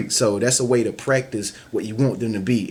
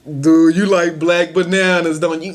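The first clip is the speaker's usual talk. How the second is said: louder, higher mocking tease; loud teasing jab, pitched up; teasing friend; 6 semitones higher, 6 dB louder than usual. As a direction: needle them about their bananas, loud and high.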